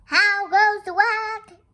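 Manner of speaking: loud, slow singsong farmer's call; singsong shout across the farmyard. Acted. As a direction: holler it high and drawn out. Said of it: "called the farmer".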